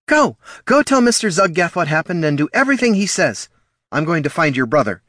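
high loud frantic command; barking orders. A narrator's reading. Mother ordering pups in panic, loud barked command. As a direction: bark it at the pups. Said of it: "barking the order".

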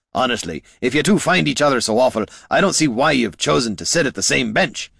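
high, loud, hurried telling-off; exasperated scolding. A narrator's reading. Adventurer telling off squabbling mages, exasperated scolding. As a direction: rattle it out in exasperation.

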